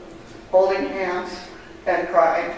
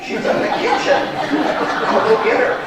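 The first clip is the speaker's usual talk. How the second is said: high, laughing retelling of a kind reply; laughing quote; 12 semitones higher, 5 dB louder than usual. Retold or imitated. Retelling the church greeter's answer, high and laughing.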